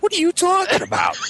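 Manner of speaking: very high-pitched bewildered question; incredulous. Retold or imitated. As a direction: retell it in squeaky disbelief. Retelling his bewildered answer.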